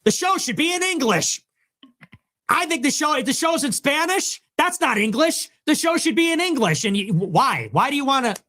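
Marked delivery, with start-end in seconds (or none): 0.0-6.8 very high, put-on indignant voice; mock complainer. Host giving audience the take they want, high mocking indignation. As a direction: parody an outraged viewer, pitched way up.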